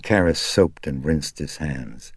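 ordinary delivery

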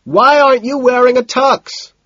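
high, loud, sustained yell; loud, high query; shouted question. A narrator's reading. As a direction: holler the question over music. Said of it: "Madison shouts".

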